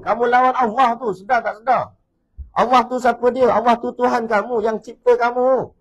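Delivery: high, loud preacher's rebuke; full-force sermon rebuke of those who defy Allah; fiery preaching. Acted. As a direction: thunder it at the congregation.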